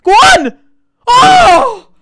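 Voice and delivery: screaming high and loud, then a cry of anguish; desperate yell. Real, not acted. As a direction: roar him on, then howl at the miss. Urging striker, then miss.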